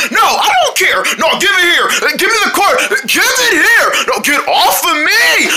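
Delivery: high, insistent demands; heated squabble. Acted. Friends fighting over the quarter, high and heated. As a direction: bicker at full tilt.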